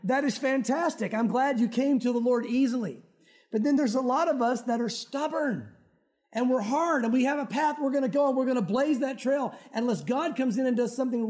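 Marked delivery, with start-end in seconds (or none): none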